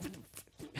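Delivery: voiceless whisper under the breath; whispered what the f. Retold or imitated. Retelling a friend's bad night, whispered to himself. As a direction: mutter it to yourself unheard. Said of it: "saw you literally go like".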